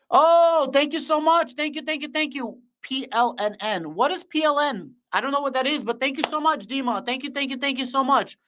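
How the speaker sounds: high, bright-eyed gratitude for a donation; excited thanks. Real, not acted. Thanking a donor on stream.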